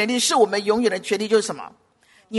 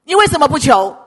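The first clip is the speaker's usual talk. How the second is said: loud, higher-pitched challenge; forceful challenge; 4 semitones higher, 9 dB louder than usual. Real, not acted. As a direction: demand an answer loudly. Repeated challenge to congregation, first forceful. (高声质问)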